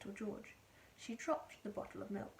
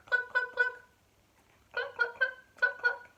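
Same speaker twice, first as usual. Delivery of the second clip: high, loud hen clucks; clucking hen; 9 dB louder than usual. A narrator's reading. The giant hen clucking before laying the egg.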